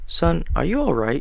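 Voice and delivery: high, concerned mother voice; worried mom checking on son. Acted.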